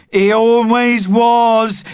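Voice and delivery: high, loud, slow mocking yell; boy yelling an insult, loud yell. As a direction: yell it back scornfully. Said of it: "yelled Kevin".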